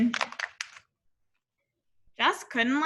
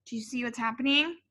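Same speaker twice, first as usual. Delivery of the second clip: ordinary delivery, nothing marked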